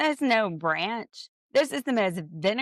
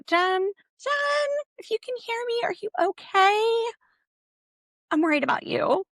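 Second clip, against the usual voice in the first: calling out. Pretend. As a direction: call out for her as if she's lost far away. Calling out to missing Jen.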